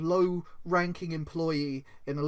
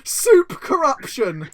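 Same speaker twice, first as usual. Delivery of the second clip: much higher and louder, slow, epic trailer announcer; booming movie trailer voice; 8 semitones higher, 8 dB louder, slower than usual. Shown as a demonstration. Pitching the soup movie title to his co-host, epic trailer boom. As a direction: boom it out slowly like a blockbuster trailer.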